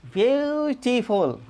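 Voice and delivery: ब्यूटीफुल very slow, long 'yoo' glide; slow model pronunciation. Shown as a demonstration. Teacher pointing out the यू sound, slow stretched demo. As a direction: stretch ब्यूटीफुल: byoo - ti - ful. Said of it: "यहाँ पर यू उच्चारण है".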